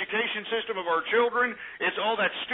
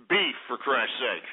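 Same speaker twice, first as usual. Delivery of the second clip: slow, lower Beef, for Christ's sake with Beef leaned on; exasperated; 6 semitones lower, slower than usual. Real, not acted. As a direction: drop low and drag out Beef. Exasperated correction.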